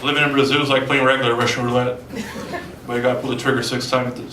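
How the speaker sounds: ordinary talk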